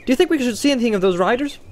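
high, quick, chirpy question; breezy, high-pitched curiosity; cheerful. A narrator's reading. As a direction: ask it brightly, without a care. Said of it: "asked Pippin cheerfully".

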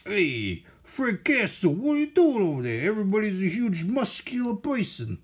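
lightly mock the comic's bruisers; lightly voiced thug. Voicing a thug calling out to Frank Castle, lightly mock tough.

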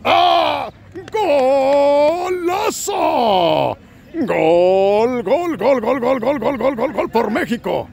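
long drawn-out shouted golazo; high, loud gol gol gol cry; goal call. Acted. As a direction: scream the goal like a TV announcer. Goal celebration commentary.

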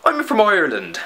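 comic put-on Irish accent. Shown as a demonstration. Vlogger demonstrating accents by country name, Irish accent. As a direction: say it as a mock Irishman. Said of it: "say in that accent is the name".

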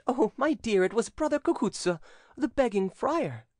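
high-pitched, excited gossip; gossiping damsel. A narrator's reading. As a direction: share the news in a high girlish voice. Cousins gossip excitedly at procession.

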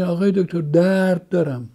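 patient telling the doctor about the pain, slowly and plaintively; slow suffering complaint. Shown as a demonstration. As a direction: stretch out «درد دارم» slowly, like a patient in pain.

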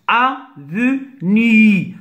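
Avenue very slowly in three separate beats, a, ve, nue; syllable by syllable. Shown as a demonstration. Teacher cutting the word for pupils, Avenue in three slow syllables. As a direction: split Avenue with a pause after each syllable. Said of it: "se compose de trois syllabes".

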